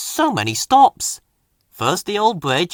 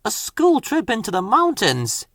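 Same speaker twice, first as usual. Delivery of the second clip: moaning; 7 semitones higher than usual. Acted. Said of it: "he moaned to Rusty".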